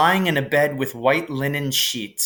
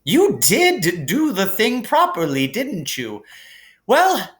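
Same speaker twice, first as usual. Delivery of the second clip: higher, gently amused headmaster voice; kindly old headmaster; 10 semitones higher, 3 dB louder than usual. Acted. Dumbledore calming Harry in the hospital wing, gently amused. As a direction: soothe the boy like a kindly wise old wizard.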